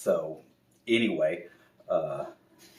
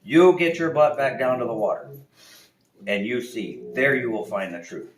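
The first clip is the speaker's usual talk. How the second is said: louder, higher scolding; scolding owl; 5 semitones higher, 9 dB louder than usual. Acted. Owl sending the bear back, louder scolding. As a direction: tell him off firmly. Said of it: "And he says".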